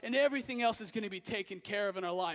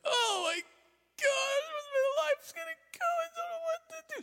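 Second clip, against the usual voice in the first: mock bawling; 3 dB louder than usual. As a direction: ham up a heartbroken breakdown. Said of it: "I was bawling".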